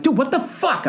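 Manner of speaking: high-pitched exasperated yell; re-enacted yell. Frustrated man to his phone app, yelling high. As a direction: cry out in disbelief, voice shooting way up. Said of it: "I would literally yell that loud".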